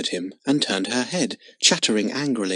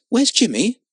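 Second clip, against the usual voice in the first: a lightly voiced little girl, high and eager; little girl asking; 10 semitones higher than usual. A narrator's reading. Little girl asking her mother where Jimmy is. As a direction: ask it like a small girl looking for her friend. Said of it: "asked Lotta".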